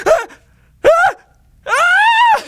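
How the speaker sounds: high loud pained screams. Retold or imitated. Burned patient shrieking as the cuff squeezes his arm. Blood pressure cuff pain.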